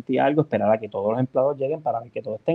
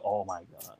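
mock dismay; 6 semitones lower, 14 dB quieter, slower than usual. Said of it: "y yo".

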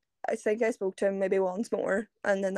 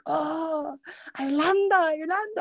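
higher, lilting Irlanda, Irlanda; imitating Italian man; 7 semitones higher, slower than usual. Friend mimicking the Italian man repeating her country. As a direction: echo the word like a delighted Italian guy, lightly.

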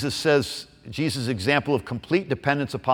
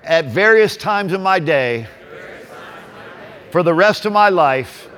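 preaching; 4 semitones higher than usual. Acted. Preacher builds congregation up.

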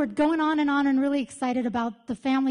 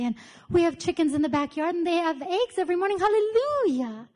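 gushing hostess impression; 4 semitones higher than usual. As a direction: bubble over like an overjoyed host mom. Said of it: "And she talked like this".

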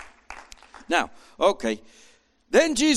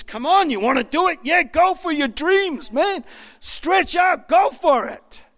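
much higher, louder, hyped encouragement; eager cheering on; 12 semitones higher, 5 dB louder than usual. Retold or imitated. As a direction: pump him up to chase his dreams. Preacher voices Jesus encouraging Peter.

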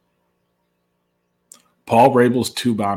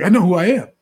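high, thrilled bragging; excited hype man; 9 semitones higher than usual. Retold or imitated. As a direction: crow about being recognized. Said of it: "It's like".

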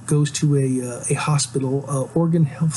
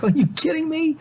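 high, incredulous are you kidding me; much higher, stunned question; disbelieving outrage; 13 semitones higher than usual. Real, not acted. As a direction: shoot it way up in disbelief. Outraged at a cheap sellout.

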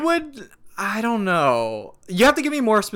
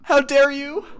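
higher, hammed-up outrage; mock outrage; 9 semitones higher, 3 dB louder than usual. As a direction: overplay the offended gasp. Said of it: "let's roleplay this".

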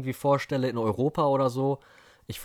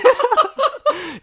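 very high laugh, falling away; high squealing laugh; 10 dB louder than usual. Real, not acted.

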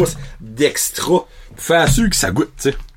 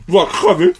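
much higher, pained cry; high-pitched groan. Real, not acted. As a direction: cry out from the burn. Burning from spicy sauce.

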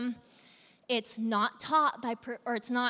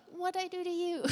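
high, soft, playfully hurt; hammed-up little hurt voice; mock wounded plea; 7 semitones higher, 5 dB quieter than usual. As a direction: play the innocent victim, small and high. Imagined counseling chat with Judas.

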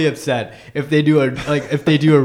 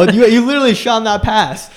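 loud, high emphatic riff; raised voice, joking decree; mock proclamation; 9 semitones higher, 8 dB louder than usual. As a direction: hammer it out in playful disbelief. Riffing loudly on Gandalf's line.

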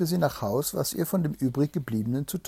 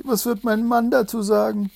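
higher, distressed princess voice; a lightly voiced frightened princess, higher; crying out; 9 semitones higher, 4 dB louder than usual. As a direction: wail it in worry, pitched up. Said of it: "rief sie".

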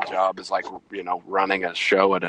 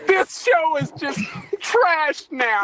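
mock whine; slower than usual. Mock despair over show.

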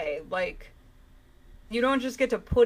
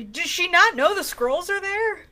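incredulous question; 9 semitones higher, 6 dB louder than usual. Real, not acted. Incredulous rant about plot.

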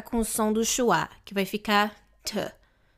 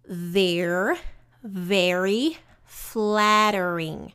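slow drawn-out model; much slower than usual. Shown as a demonstration. Teacher drags out the word.

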